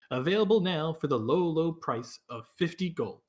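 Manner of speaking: hammy fantasy advertisement voice; ad read. Acted. Mock sponsor ad read.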